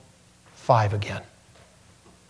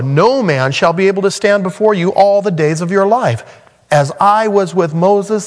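higher and louder, full-force sermon voice; fiery preaching; 6 semitones higher, 4 dB louder than usual. Acted. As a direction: proclaim it to the congregation.

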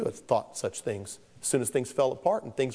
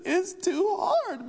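put-on much higher, slow, tearful child wail; a six-year-old sobbing over math; crying little boy; much slower than usual. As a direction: stretch it out like a weepy kid. Six-year-old breaking into tears.

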